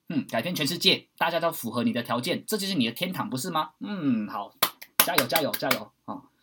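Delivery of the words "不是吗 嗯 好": ordinary talk, nothing marked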